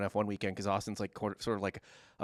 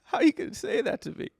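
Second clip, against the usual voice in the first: rising into a whiny protest; pleading, hurt, then a high indignant whine; mock wounded self-pity; 5 semitones higher, 4 dB louder than usual. Husband protesting to wife about his accents, mock hurt. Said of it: "I just looked at her like".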